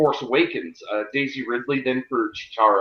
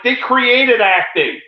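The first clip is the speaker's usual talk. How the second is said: slow, higher and louder, every word leaned on; emphatic retort; 9 semitones higher, 6 dB louder, slower than usual. Retold or imitated. Telling a friend in an argument, emphatic slow retort. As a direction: drive home the clinching argument. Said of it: "And I'm like".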